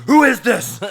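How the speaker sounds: loud high challenge; suspicious demand. Retold or imitated. Answering the scam call, loud suspicious demand. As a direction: confront the caller. Yelling at scammer.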